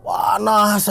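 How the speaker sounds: drawn-out, wailing cry for help; stretched-out pleading wail; mock yelling. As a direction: re-enact crying out in pain. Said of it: "saya teriak-teriak".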